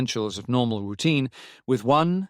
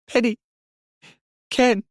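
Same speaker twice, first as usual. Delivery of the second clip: high, loud cries of names; acted child's distress, much higher; sobbing child; 12 semitones higher, 5 dB louder than usual. A narrator's reading. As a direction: call out tearfully, word by word. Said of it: "she sobbed".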